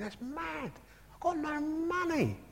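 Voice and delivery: high, soft, slowed panicking inner thought; panicky thought. Retold or imitated. To himself after giving away the money, high and panicky. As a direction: squeak out his own dismay at being broke.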